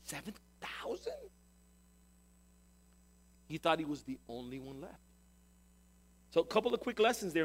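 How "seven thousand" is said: hushed, stunned question rising in disbelief; jaw-dropped astonishment. Acted. Elijah learning he is not the only one, jaw-dropped astonishment. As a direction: echo the number softly, dumbfounded. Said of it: "his jaw dropped".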